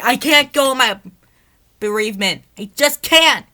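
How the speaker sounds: very high, loud anguished yell. Acted. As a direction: yell it out in grief. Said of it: "Emily yelled".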